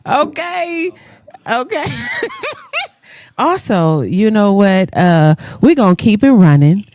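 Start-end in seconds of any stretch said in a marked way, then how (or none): 1.9-2.9 short high-pitched giggle; high laugh. Real, not acted. Laughing after her joke.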